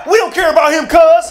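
high, loud jeering; gang taunt. Retold or imitated. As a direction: jeer at him from the car. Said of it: "gang taunt voiced".

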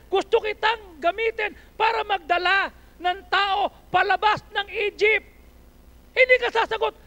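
high, loud, impassioned preaching; full-force preaching. Acted. Pastor preaching at full force to the young people. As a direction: shout the sermon to the congregation.

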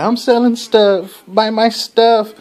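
high, slow, cheery sing-song hawking; high, drawn-out merchant's sales call; happy robot seller. Retold or imitated. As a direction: chirp it out like a merry shopkeeper robot.

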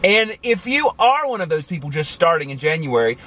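ordinary talk, nothing marked

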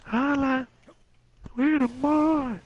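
high slow goofy voice; high put-on voice. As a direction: stretch it out high and silly. Echoing a buddy's catchphrase in a put-on voice.

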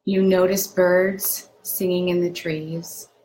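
unhurried meditation guide with long pauses between phrases; guided meditation. Acted. Doctor leading viewers through a lakeside meditation, unhurried guided-meditation delivery. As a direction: guide them gently into calm, phrase by phrase.

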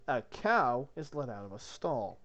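ordinary talk, nothing marked

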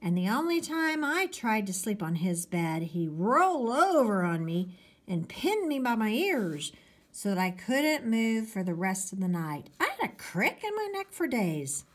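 usual speaking voice

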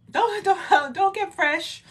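high, loud mock telling-off; mock parental scolding. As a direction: scold a cheeky child in play. Acts out parent scolding cheeky child.

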